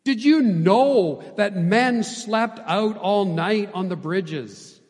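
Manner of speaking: loud, slow, incredulous-style demand; fiery challenge. A narrator's reading. Elder Booth launches at his son, fiery challenge. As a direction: hammer the question out slowly, then ease off. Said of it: "this fiery response".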